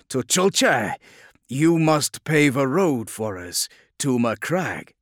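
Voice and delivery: loud, high, measured order; slow, forceful command from a warlord. A narrator's reading. The Lion ordering Tuchulcha to pave a road, commanding.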